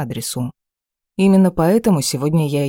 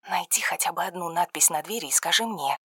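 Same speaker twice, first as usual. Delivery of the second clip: much softer, hushed phone instruction; 3 semitones higher, 9 dB quieter than usual. A narrator's reading. Подруга говорит тихо по телефону. (тихо, вполголоса)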